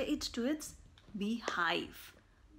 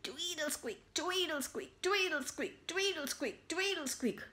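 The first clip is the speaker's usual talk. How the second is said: higher, sing-song squeaks; squeaky bicycle; 6 semitones higher than usual. Acted. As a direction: imitate a creaky bike.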